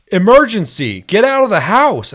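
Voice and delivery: robotic emergency announcement yelled through the house; loud alarm voice. Retold or imitated. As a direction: blast it like a detector's recorded alarm. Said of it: "I hear a guy go".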